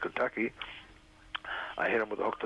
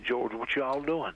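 put-on drawn-out, higher Kentucky accent; 4 semitones higher, much slower than usual. Commissioner comes over to question the boxer, Kentucky accent. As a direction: imitate a slow Kentucky drawl. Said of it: "in his Kentucky accent".

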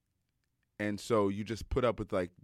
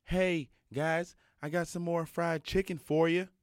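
higher, a lightly put-on Mississippi drawl; imitating Mississippi accent; 9 semitones higher, slower than usual. Coach calls the players to eat, Mississippi accent. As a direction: coach offering chicken in a mild Mississippi accent. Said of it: "he's from Mississippi, so he's got this accent".

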